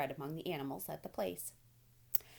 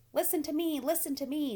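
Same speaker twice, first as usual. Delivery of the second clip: loud, high goose scream; 9 semitones higher, 6 dB louder than usual. A narrator's reading. Goose screaming at Wilbur for attention, loud and high. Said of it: "screamed the goose".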